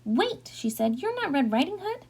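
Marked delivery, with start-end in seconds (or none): 0.1-0.5 high-pitched, taken aback; startled grandmother. A narrator's reading. Grandmother opening the door to the wolf, startled. 1.0-2.1 high, puzzled question; startled grandmother. A narrator's reading. Grandmother seeing it is not her granddaughter, startled.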